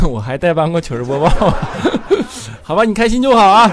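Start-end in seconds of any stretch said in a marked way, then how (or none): 1.3-2.6 high, breaking-up laugh; real laugh. Real, not acted. Host amused at having guest-hosted another show, laughing. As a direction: burst into helpless high laughter.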